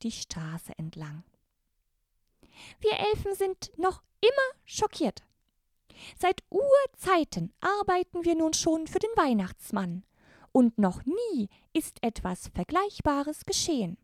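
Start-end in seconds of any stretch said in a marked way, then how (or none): 2.8-14.0 very high, squeaky elf voice; Christmas elf. Acted. The elf Sternenlicht greets the brave girls, very high elfin voice. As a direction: greet them as a tiny, courtly elf.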